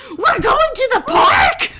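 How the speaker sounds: put-on high, loud, gleeful shout of kids; excited kids shouting. Three boys charging at their dad, shouting with glee. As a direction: kids tearing through the house, yelling with joy.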